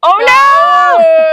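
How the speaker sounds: high, loud mock outcry; big theatrical wail; hammed-up shout. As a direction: shout it in playful alarm. Mock panic after intimidation attempt.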